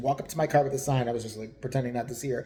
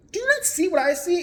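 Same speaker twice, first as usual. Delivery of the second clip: much higher, pressing on not; can you believe this, pitched way up; incredulous; 13 semitones higher, 4 dB louder than usual. Retold or imitated. Narrator to boyfriend Steve, replaying an incredulous high plea.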